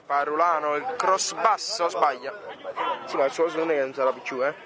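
live play-by-play commentator; sports commentary. Acted. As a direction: call the match as it happens. Match play-by-play.

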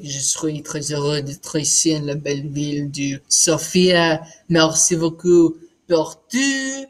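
speaking French as a demo. Shown as a demonstration. Showing off languages to the interviewer, speaking French. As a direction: show off a greeting in French. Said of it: "French is like".

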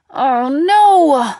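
loud, high wail of dismay; horrified cry. A narrator's reading. Cry as the lamp shatters, horrified. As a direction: cry out in horror, stretching the last word.

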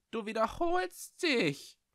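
a hostile little voice from the dark; thin, sharp bat voice, hostile. Acted. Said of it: "eine dünne Stimme scharf und feindselig".

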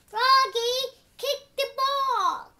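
loud call to kick the ball; shouted order. Acted. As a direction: shout it across the field. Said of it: "Then said".

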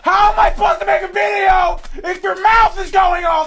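high-pitched, very loud angry rant; screaming. Real, not acted. Gamer scolds noisy household while recording, screaming. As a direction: yell it all at full force. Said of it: "my throat hurts now for screaming".

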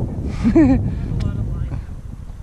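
high breathy giggle; unvoiced amused giggle; airy laugh. Real, not acted. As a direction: laugh at the tangle. Laughing at tangled line.

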